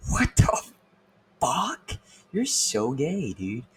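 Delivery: incredulous. Retold or imitated. Retold reaction to gym guy.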